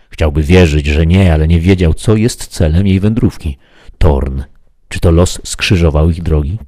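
plain reading voice

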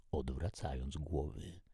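plain reading voice

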